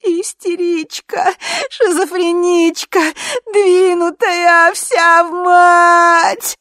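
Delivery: very high, drawn-out wail; slow, high, loud keening; howling. A narrator's reading. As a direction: howl it, stretching every word. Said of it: "выла Ви".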